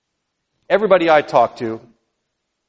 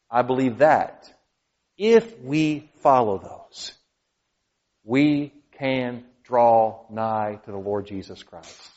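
earnest closing; 4 dB quieter, much slower than usual. Acted. Slow soft closing.

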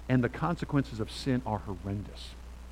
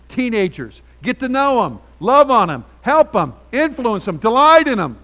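loud, higher, driving pulpit exhortation; preaching at full force; 6 semitones higher, 10 dB louder than usual. Acted. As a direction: push each phrase out to the congregation.

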